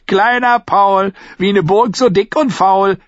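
hoher, langsamer Spottvers; sing-sang ihn verhöhnend vor; spöttisch. A narrator's reading. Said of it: "spöttelte er". (Lark verspottet den kleinen Paul mit hohem Singsang)

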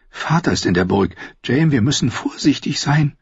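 lightly voiced anxious woman; cautioning Cersei.

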